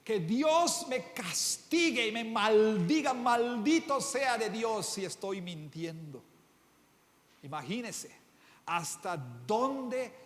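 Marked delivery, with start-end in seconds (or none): 0.0-6.2 slow, loud desperate oath; acted oath. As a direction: swear it slowly with full force. Peter curses himself swearing.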